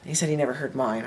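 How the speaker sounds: normal talking voice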